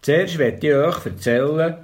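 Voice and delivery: plain reading voice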